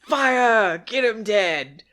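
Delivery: put-on high loud squeaky kid yelling; kid Boba impression. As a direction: yell like an overeager kid. Mocking kid Boba in film.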